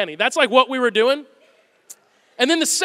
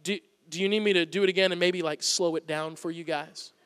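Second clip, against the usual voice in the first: patronizing; 4 semitones lower, 6 dB quieter than usual. Retold or imitated. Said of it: "he would get super patronizing".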